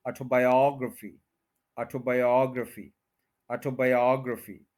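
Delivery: Autobiography as aw-to-bai-AWG-ruh-fee; fourth-syllable stress. Shown as a demonstration. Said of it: "ओ जी पे यहाँ पे दोबारा स्ट्रेस आएगा".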